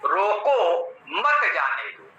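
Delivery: रोको rising, a break, then मत जाने दो falling together; pause after रोको. Shown as a demonstration. Teacher showing "stop him" reading, pause after रोको. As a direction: comma after रोको, keep मत joined to जाने दो. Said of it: "एक अल्प विराम दीजिए".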